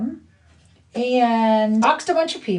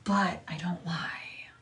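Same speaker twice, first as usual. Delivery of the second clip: breathy pointed whisper, slowed down; 4 semitones lower, 11 dB quieter, slower than usual. Real, not acted. Aside to viewers about the rival, whispered. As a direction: drop to a whisper for the jab.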